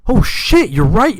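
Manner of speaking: high, loud burst of realization; sudden realization. Retold or imitated. Buddy realizing the story was true, loud surprised burst. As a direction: jump up in pitch as it clicks.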